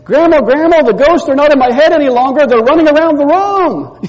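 put-on high, loud, excited child's call; little boy crying out. Grandson calling his grandma back about ghosts, high and loud. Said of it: "this little voice cried out again".

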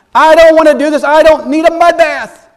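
acted tantrum, shouted high; furious refusal. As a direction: yell it like an outraged patient refusing treatment. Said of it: "he's ticked off by the prescription".

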